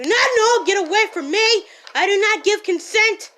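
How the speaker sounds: loud, high outraged shouting; indignant yell. Acted. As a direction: yell your refusal at them. Yelling at attacking bugs.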